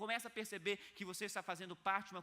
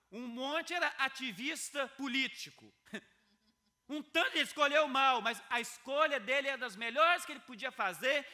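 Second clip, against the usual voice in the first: full-force preaching; 6 semitones higher, 10 dB louder than usual. Acted. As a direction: preach it out loud to stir the room.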